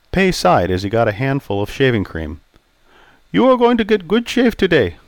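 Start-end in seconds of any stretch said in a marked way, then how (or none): 3.3-5.0 put-on raised, accented old barber voice; polite Chinese-accented barber greeting. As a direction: voice Pei the barber, courteous.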